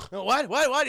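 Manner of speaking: hammed-up groggy alarm; startled awake. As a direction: act jolted out of a nap. Student jolted awake by teacher.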